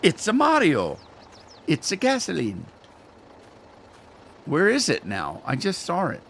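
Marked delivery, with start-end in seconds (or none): 0.0-1.0 hammed-up Italian accent, higher, ending on "Mario"; a playful, cartoon Italian "It's a Mario"; mock Italian. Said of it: "You're not from Italy". 1.7-2.6 mock Italian. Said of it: "You're not from Italy".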